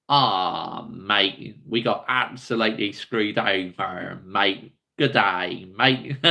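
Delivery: low-pitched Australian impression; Australian accent. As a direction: put on a deep Aussie accent. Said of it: "I'm quite good at doing the Australian accent".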